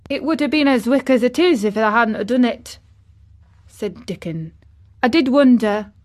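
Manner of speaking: put-on broad, lively moorland boy; Dickon. A narrator's reading. Dickon marvels at the pruned garden, lively.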